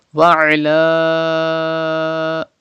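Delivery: "Wa ala" with a very long final vowel; elongated madd. Shown as a demonstration. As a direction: sustain the last a of "Wa ala" for five counts. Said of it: "stretch Khada Zabar".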